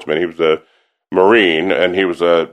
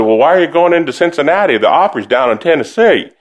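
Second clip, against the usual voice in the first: higher, lightly voiced puzzled Southern grandma; grandma confused about opera in Cincinnati, lightly voiced; Southern grandmother; 7 semitones higher than usual. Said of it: "she said".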